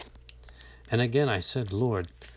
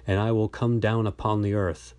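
usual speaking voice

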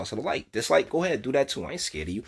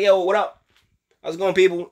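casual group greeting; 6 semitones higher, 4 dB louder than usual. Shown as a demonstration. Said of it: "I'm just like".

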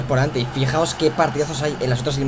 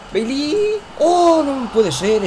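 excited; 10 semitones higher, much slower than usual. Real, not acted. Near miss during the match.

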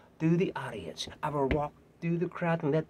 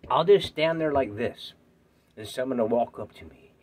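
ordinary talk

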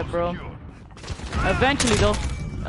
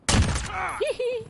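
high little hee hee giggle; 11 semitones higher than usual. Pretend. Giggling after a kill.

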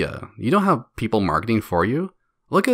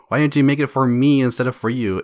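ordinary talk, nothing marked

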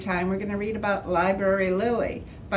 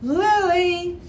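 high, louder call of the name; Mom calling; 11 semitones higher, 6 dB louder than usual. Acted. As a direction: call her like a mom down the hall. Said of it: "said Mom".

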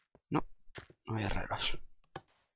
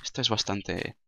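ordinary talk, nothing marked

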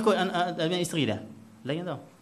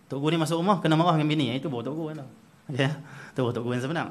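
ordinary talk, nothing marked

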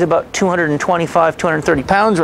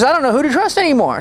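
imitating her higher, upset and on the verge of tears; tearful frustration; 10 semitones higher than usual. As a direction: voice her despair, pitched up and tearful. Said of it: "so she started crying".

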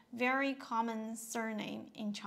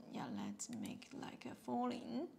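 whispered aside; 6 semitones lower, 7 dB quieter than usual. Real, not acted. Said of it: "unvoiced whisper".

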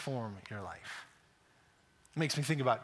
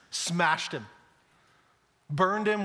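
usual speaking voice

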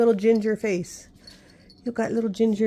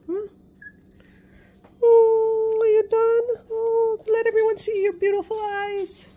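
much higher and louder doting pet voice; 11 semitones higher, 7 dB louder than usual. Real, not acted. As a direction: gush over the puppy. Gushing over the dog.

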